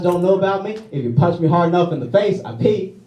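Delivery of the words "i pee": ordinary talk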